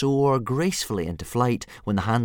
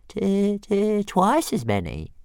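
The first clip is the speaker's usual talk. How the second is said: high, slow, stuttering dragon boast; modest little dragon; 13 semitones higher, 5 dB louder, slower than usual. Acted. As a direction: brag modestly like a proud pet. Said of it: "he said modestly".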